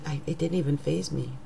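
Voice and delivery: ordinary talk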